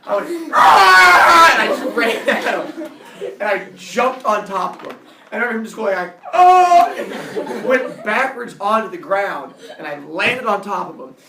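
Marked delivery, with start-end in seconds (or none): 0.5-1.5 loud, high battle roar; charging roar. Retold or imitated. Kid charging his bully, loud high roar. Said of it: "I roared". 6.3-6.9 loud, high "oh" of impact; startled cry. Retold or imitated. Bully jumped on by the kid, loud high cry.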